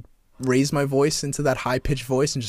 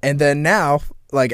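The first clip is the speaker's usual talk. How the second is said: usual speaking voice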